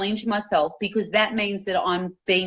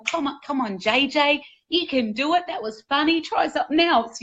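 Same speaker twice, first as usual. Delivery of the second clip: higher-pitched, coaxing pep talk; encouraging inner coach; 5 semitones higher than usual. Acted. Best coach within your head urging the speaker on, encouraging. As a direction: cheer yourself on like your own best coach. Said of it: "best coach within your head going".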